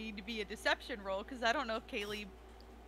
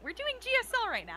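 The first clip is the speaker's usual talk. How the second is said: high fast joke; 13 semitones higher, 4 dB louder, faster than usual. Pretend. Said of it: "you don't sign in the Southern accent".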